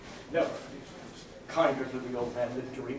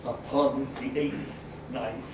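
plain reading voice

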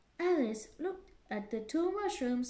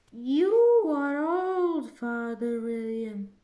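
slow, loud declaiming; reciting verse; 2 semitones higher, 10 dB louder than usual. Acted. Alice reciting the poem again, loud and slow. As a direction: belt out the verse line slowly.

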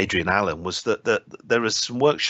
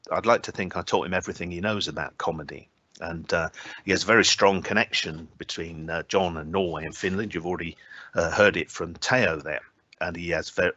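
normal talking voice

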